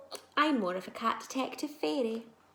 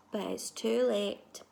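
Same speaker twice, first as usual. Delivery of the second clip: ordinary delivery, nothing marked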